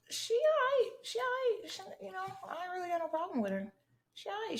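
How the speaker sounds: put-on high-pitched voice; noncommittal high voice. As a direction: go way up high, dodging judgement. Lukewarm verdict on ex's mom.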